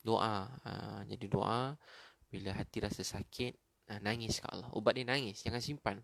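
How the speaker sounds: ordinary delivery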